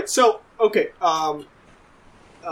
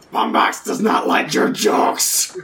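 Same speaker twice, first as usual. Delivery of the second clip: slow, husky captain voice; captain's character voice; slower than usual. Acted. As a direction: speak slowly as the displeased captain. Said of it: "so the captain says".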